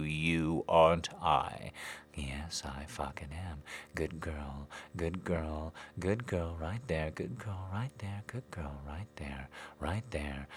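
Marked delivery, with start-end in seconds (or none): none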